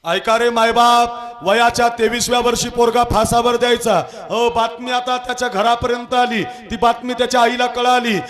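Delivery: high, loud kirtan preaching; fiery preaching. Acted. Preacher rousing the audience, loud and high. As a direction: call out to the crowd at full force.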